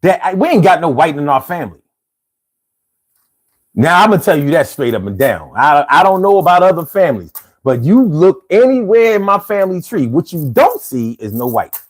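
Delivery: normal talking voice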